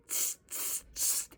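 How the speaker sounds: soft voiceless "tss" sounds; monkey chatter. A narrator's reading. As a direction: imitate monkeys with little tongue-and-teeth noises.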